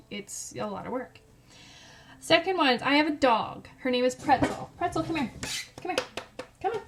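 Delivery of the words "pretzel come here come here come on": short, high-pitched calls; calling a dog. Real, not acted. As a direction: coax your dog over in quick bursts.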